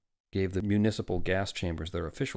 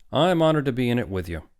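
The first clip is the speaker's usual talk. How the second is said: loud, higher booming gallantry; hearty; 5 semitones higher, 9 dB louder than usual. A narrator's reading. Doctor returns the woman's compliment, hearty. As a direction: boom it with weight on the first word. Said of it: "said Doctor Hitz heartily".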